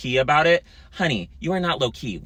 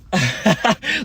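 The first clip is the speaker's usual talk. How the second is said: shocked gasp-laugh; 8 semitones higher, 4 dB louder than usual. Retold or imitated. Mimicking her shocked reaction.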